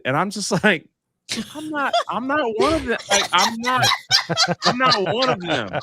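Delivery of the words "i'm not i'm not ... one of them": high insistent protest. Retold or imitated. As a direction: plead your case, pitched up. Protesting to handler.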